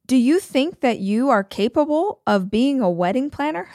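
condescending sneer, pitch jumping up; rude mock. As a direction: mimic a rude boss talking down. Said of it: "She was so rude".

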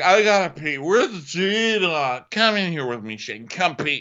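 put-on high, whiny drunken plea; drunk Emily. Drunk wife begging her husband, high whine.

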